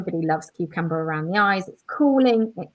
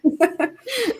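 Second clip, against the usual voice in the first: higher, louder, breaking into a real laugh; amused laughing; 5 semitones higher, 6 dB louder than usual. Real, not acted. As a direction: say it brightly, then burst out laughing. Amused aside about a colleague.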